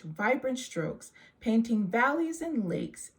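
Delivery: ordinary delivery, nothing marked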